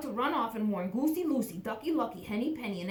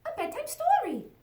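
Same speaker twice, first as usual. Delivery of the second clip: high, eager little chick voice; little chicken voice; 5 dB louder than usual. Acted. Chick asking her papa for a bedtime story, high and eager. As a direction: squeak it up like a small excited chick.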